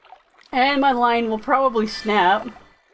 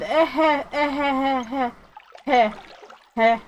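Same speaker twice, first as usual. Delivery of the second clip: sheepish chuckle; 3 semitones higher than usual. Real, not acted. Laughing at spending all his money.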